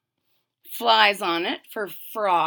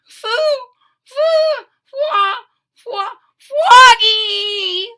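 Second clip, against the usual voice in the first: put-on much higher, loud slow baby wail; 13 semitones higher, 12 dB louder, much slower than usual. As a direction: sob out the brother's name. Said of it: "wailed Polly".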